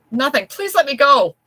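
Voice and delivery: high, loud desperate plea; frantic pleading. Acted. Wife held by the collar begs her husband in panic. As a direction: beg to be let go, near screaming. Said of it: "Avril pleaded".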